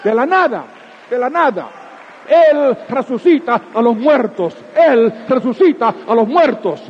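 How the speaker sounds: loud, high fervent preaching; preaching at full force. Acted. Preacher proclaiming resurrection to the congregation, fervent loud preaching. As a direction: drive the point home to the congregation.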